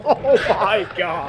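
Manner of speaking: laughing. Real, not acted. Reacting to the clip.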